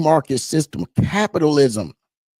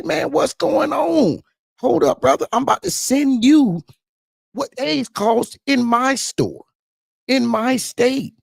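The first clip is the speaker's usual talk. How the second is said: high, drawn-out incredulous voice; retold disbelief; 13 semitones higher, slower than usual. Retelling a call to his buddy in Texas, incredulous. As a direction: stretch it out high, acting out astonishment.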